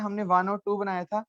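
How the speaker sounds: ordinary talk, nothing marked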